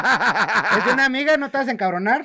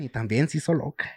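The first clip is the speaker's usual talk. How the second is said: normal talking voice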